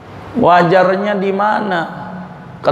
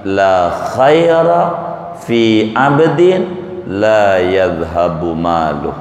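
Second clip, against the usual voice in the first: solemn Arabic recitation; 8 semitones lower, slower than usual. Acted. Quoting hadith in Arabic.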